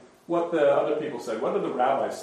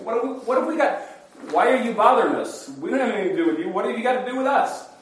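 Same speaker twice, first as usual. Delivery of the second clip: high, agitated protest; crying out; 6 semitones higher, 3 dB louder, faster than usual. Acted. Demon asks Jesus why he bothers them, crying out. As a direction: push the questions up high, complaining. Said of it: "the man, cried out".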